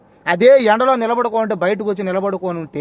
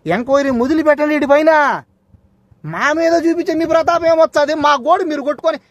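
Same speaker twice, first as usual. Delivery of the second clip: higher pitched, rising defiant challenge; heated dare; 6 semitones higher than usual. Real, not acted. Daring the officer to open an inquiry, heated and higher. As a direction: dare him to start the inquiry.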